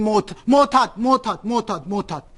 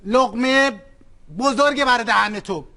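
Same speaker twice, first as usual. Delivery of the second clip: ordinary delivery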